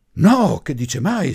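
high, loud, drawn-out indignant protest; shocked, offended outburst. A narrator's reading. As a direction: recoil in offended astonishment, stretching the words. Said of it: "scattò la vecchia signora, stupita, offesa". (scatto stupito e offeso, acuto e forte)

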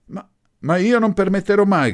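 stammered start, high and loud; outraged shout. A narrator's reading. Old lady cutting the professor short, outraged shout. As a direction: cut in, shouting indignantly. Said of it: "gridò più forte di lui".

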